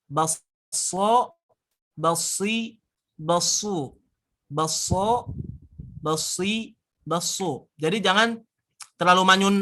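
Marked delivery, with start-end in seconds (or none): 0.1-7.7 Baso, basi, basu with a light whistling s; whistling s demo. Shown as a demonstration. Teacher drilling the whistling sad with students. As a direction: let the s whistle a little, lips not too pursed. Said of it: "Tapi sedikit aja".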